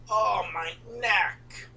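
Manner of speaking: slow, voiceless strained moan; mock pained groan. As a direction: imitate a rider nursing an injury. Imitating hurt riders after the ride.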